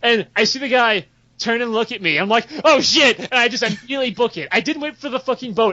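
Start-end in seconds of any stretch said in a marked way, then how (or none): 2.6-3.2 a sudden, louder "oh shit" with a jump up in pitch; panicked outburst in a retold game moment; startled yelp. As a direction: blurt it out as if he's just been spotted. Startled on seeing the guy.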